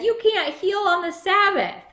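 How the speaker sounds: mildly voiced disapproving townsfolk; disapproving onlookers. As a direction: play the upset crowd, lightly. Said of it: "they said".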